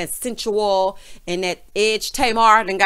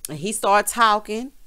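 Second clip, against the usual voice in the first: ordinary talk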